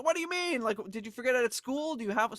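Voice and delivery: high, baffled questioning; surprised. Retold or imitated. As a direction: react in disbelief. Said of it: "I was like".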